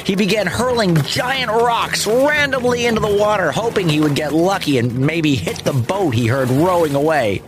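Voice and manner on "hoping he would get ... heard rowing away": ordinary delivery, nothing marked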